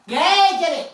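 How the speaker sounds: high, loud cheer; thrilled whoop; excited praise. Real, not acted. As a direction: cheer the dog on to the reward. Cheering the dog on.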